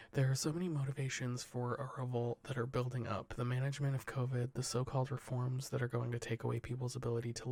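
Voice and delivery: steady newsreader delivery; news read. Acted. Podcast host reading the headlines to cohosts, newsreader style. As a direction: read the wire report to the cohosts, measured news voice. Reads news stories aloud.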